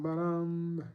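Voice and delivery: ordinary talk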